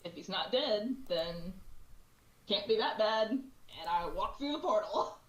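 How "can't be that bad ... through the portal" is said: ordinary delivery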